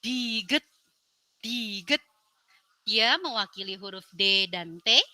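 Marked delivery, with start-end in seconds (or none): 0.0-2.0 diget said carefully with a rise; letter name demo. Shown as a demonstration. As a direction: model diget twice.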